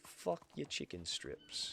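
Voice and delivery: mock sneer. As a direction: sneer it softly and slowly. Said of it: "That's all I gotta say".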